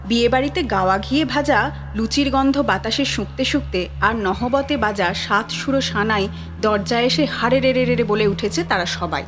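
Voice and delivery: ordinary delivery, nothing marked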